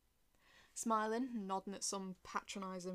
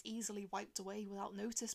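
ordinary delivery

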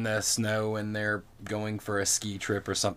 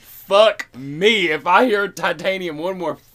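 exasperated outburst; 8 semitones higher, 7 dB louder than usual. Real, not acted. Exasperated rant about [name] song.

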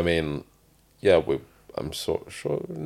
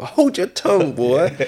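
stern elder; 13 semitones higher, 8 dB louder than usual. Pretend. Mock scolding reply to teasing.

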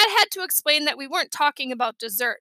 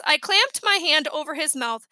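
ordinary delivery, nothing marked

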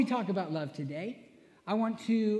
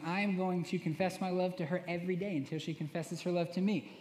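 normal talking voice

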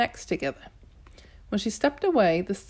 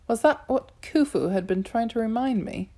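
usual speaking voice

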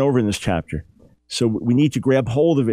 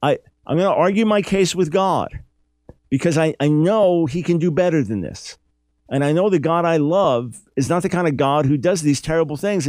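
higher, earnest Job demanding a hearing; Job's impassioned protest, higher; voicing Job; 5 semitones higher than usual. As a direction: plead with God as Job would. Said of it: "So Job's saying".

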